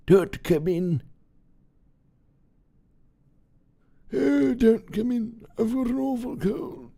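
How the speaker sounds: very high, put-on old-woman voice; plaintive excuse in a high, slowing voice; old lady feigning illness. Voicing Sophie hiding in her room.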